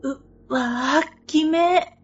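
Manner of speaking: high squeaky eww, grossed out; recoil, grossed out and creeped; disgusted shudder. Retold or imitated. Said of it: "と私".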